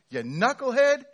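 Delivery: very loud, high scolding rant; angry landowner. Pretend. Landowner scolding ungrateful workers, loud and high. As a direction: chew them out as the offended boss. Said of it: "the response here ... to be like".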